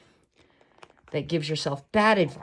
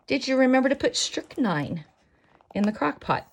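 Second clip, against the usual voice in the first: a higher, sneaky suggestion; sly inner voice; 6 semitones higher than usual. Acted. The bad little voice suggesting poison, slyly raised. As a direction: tempt yourself like a mischievous imp, pitched up. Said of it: "That's a voice you need to ignore".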